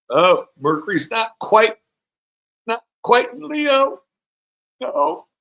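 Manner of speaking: very high, loud, drawn-out protest; exclaimed correction. Real, not acted. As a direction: call it out in a high voice, stretching the words. Correcting where Mercury is on chart.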